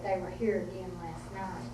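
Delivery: lightly voiced stoic grandmother, quietly; understated imitation of a grave mountain woman, softly. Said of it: "stoic woman of the mountains".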